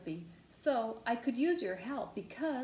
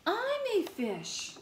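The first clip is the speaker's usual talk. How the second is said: very high, held-out cry; playful glee; 10 semitones higher than usual. Acted. Pigeon playing fish in the tub, high playful glee. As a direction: pitch it way up, playing at being a fish.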